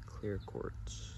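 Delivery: breathy whisper of the name. Acted. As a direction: whisper it like an ASMR tingle. Stone name repeated in a whisper.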